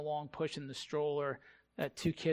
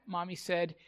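ordinary talk, nothing marked

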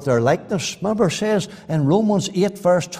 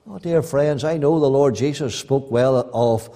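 normal talking voice

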